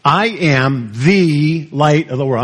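slow, with "the" drawn out as "thee"; "the" stretched and stressed, said thee. Shown as a demonstration. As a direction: hold a long "thee" before "light". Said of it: "I'm not sure what he emphasized".

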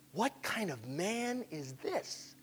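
very soft, slow wonder; barely voiced, drawn-out question; hushed amazement. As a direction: breathe it slowly in awe. Amazed disciples, first hushed take.

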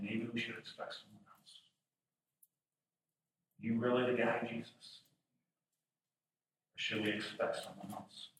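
low, hushed questioning; subdued doubt. Retold or imitated. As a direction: ask it softly, losing certainty. Voices John's later doubt.

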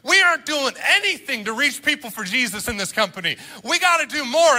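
high-pitched preachy yell, imitating a teenage self; mock harangue, punching the key words; retold yelling. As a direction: re-enact a seventeen-year-old yelling at grown-ups. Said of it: "I would yell at them".

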